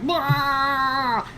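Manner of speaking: long high goblin bleat; drawn-out, silly high charging cry; goblin war cry. Acted. Game master as goblins running up with clubs, high drawn-out cry. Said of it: "they're like".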